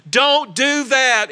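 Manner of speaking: ordinary talk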